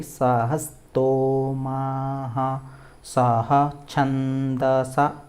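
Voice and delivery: slow, drawn-out recitation; syllable-by-syllable demo. Shown as a demonstration. Teacher breaking a mantra down for learners, slow and drawn out. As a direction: stretch each long vowel, word by word. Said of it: "झटका देके बोलना होता है".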